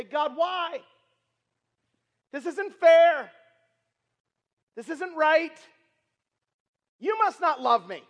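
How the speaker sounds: full-force sermon delivery, rising loud; preaching. Acted. Preacher voicing the hurting in the congregation, loud sermon cry.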